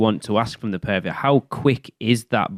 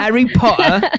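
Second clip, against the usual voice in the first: hammed-up British accent, high and loud; terrible British accent; 14 semitones higher, 6 dB louder than usual. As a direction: say it like a bad Harry Potter fan. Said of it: "terrible British accents".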